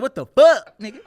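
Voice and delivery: high, loud stunned outburst; loud, high shocked cry; shocked yell. Retold or imitated. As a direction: blurt it out in disbelief. Retold shock at misspelled tattoo.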